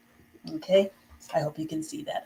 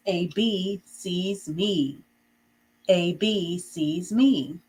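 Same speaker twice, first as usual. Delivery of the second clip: each word slow and separate; slow dictation; much slower than usual. Shown as a demonstration. Teacher dictating slowly to the class. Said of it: "now the next sentence".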